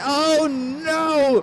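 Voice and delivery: loud, high cry of dismay; big drawn-out race-caller alarm; dismayed shout. Real, not acted. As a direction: shout it out as the cars crash. Commentator reacts to race crash.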